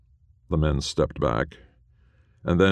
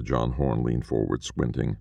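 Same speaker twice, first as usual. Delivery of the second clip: ordinary delivery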